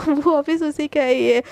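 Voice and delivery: ordinary delivery, nothing marked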